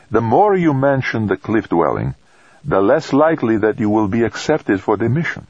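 put-on gentle priest with light Italian accent; friendly advice in a light Italian lilt; soft Italian accent. A narrator's reading. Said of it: "the hint of soft Italian vowels".

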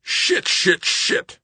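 high-pitched burst of angry swearing; frustrated cursing. A narrator's reading. Greg failing at the computer, cursing in frustration. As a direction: spit the curse out repeatedly, rising in frustration. Frustrated outburst after failed commands.

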